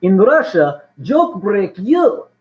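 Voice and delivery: loud mock Russian accent; hammed-up Russian accent with swooping pitch. Cracking a Soviet Russia joke, Russian accent.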